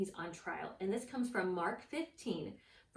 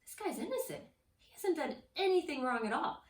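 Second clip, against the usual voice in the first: higher-pitched acted realization; dawning realization; 7 semitones higher, 3 dB louder than usual. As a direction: Pilate insisting, pitched up. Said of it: "Pilate even realized".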